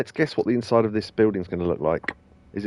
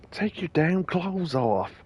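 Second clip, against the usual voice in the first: a light put-on sleazy boss voice, slow; gravel-free sleazy voice; 7 semitones higher, 4 dB quieter, slower than usual. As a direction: drag it out like a creepy agent. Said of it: "give him that voice".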